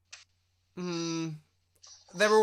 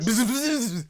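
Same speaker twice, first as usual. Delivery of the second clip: angry buzzing; 11 semitones higher, 9 dB louder, slower than usual. Retold or imitated. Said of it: "the priest getting really angry and going".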